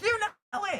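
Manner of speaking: very high-pitched mock squeal; imitated excited reply. Friend confirming the anime song, imitated high squeal.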